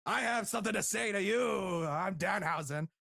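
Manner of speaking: theatrical, swooping wrestler character voice; Danhausen. Retold or imitated. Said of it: "Danhausen's like".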